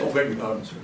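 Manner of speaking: low, soft beg-pardon; quick hushed apology to a gentleman; sheepish apology. Acted. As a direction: drop the voice, abashed at having sworn. Apologises for swearing.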